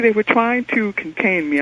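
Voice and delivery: usual speaking voice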